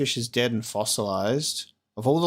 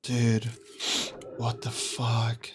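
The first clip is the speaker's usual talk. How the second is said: softer, slow, stunned disbelief; 5 dB quieter, much slower than usual. Real, not acted. Gamer stunned by the ending, slow soft disbelief. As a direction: breathe it out in shock.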